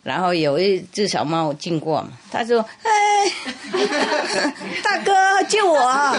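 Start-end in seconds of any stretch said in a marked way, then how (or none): 2.8-6.1 high squeaky plea broken by laughter; mouse begging, laughing. Acted. Drowning mouse begs the big cat, laughing high. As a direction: beg for rescue while cracking up.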